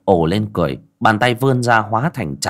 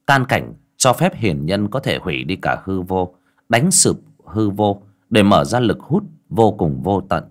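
ordinary delivery

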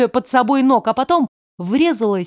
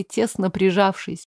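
ordinary delivery

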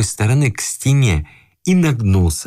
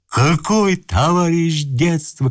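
high, drawn-out indignation; shouting; 7 semitones higher, slower than usual. A narrator's reading. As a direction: challenge him slowly, voice raised in pitch. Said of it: "кричал старик".

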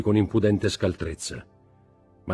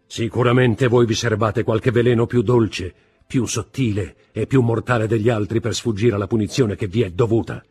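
loud, higher, driving speech; insistent accusation; 4 semitones higher, 6 dB louder than usual. A narrator's reading. Husband hints at her last poison, insistently. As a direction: press her hard, word upon word. Husband presses his wife toward suicide.

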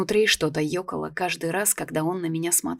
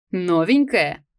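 curious guard; 6 dB louder, slower than usual. A narrator's reading. Guard asks, loud, far.

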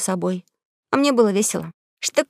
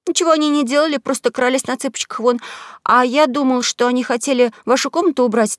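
put-on higher, eager boy protesting; pleading young boy, pitched up; boy's voice; 4 semitones higher than usual. Huck's long explanation.

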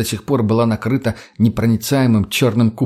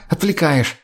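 high, curt complaint; irritated bark; 6 semitones higher, slower than usual. A narrator's reading. Lena is distracted by Mutny while working, barking. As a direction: snap in irritation. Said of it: "рявкнула Лена".

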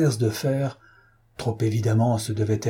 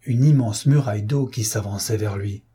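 usual speaking voice

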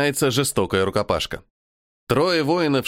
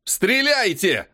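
higher drawn-out yell; 9 semitones higher, much slower than usual. Acted. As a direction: yell the order, slowly. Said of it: "Ору".

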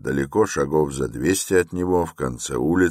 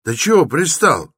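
high, loud irritated demand; getting angry; 12 semitones higher, 6 dB louder than usual. A narrator's reading. Porter fed up with the lingering stranger, angrily loud. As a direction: tell off a pest, voice raised. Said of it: "начинавший серьёзно сердиться".

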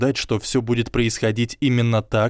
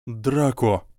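ordinary delivery

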